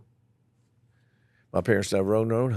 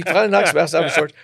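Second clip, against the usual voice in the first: usual speaking voice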